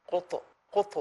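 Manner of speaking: কত said with a bouncing echo on the stop; qalqala echo. Shown as a demonstration. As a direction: bounce the final ত sharply. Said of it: "কলকলাতে উচ্চারণ হয়". (কলকলা সহ কত)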